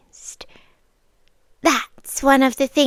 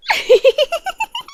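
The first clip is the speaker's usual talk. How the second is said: pleased laugh. Acted. Vampire delights in his fear.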